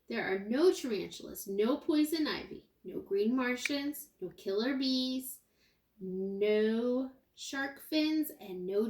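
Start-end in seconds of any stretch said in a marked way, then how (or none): none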